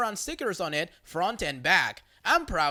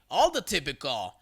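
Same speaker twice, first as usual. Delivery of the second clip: ordinary delivery, nothing marked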